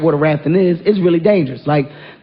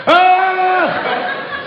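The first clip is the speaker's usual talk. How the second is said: much higher whooping shout of Ah!; excited yell; 12 semitones higher than usual. Acted. Leaning off the raft front at the waterfall, whooping yell. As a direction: whoop it like riding the front of a ship.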